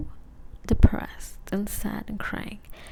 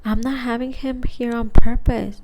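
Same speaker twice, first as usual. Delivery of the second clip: exasperated retort; 3 semitones higher, 13 dB louder than usual. Retold or imitated. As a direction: throw back an indignant, loud objection. Acted quarrel with angry friend.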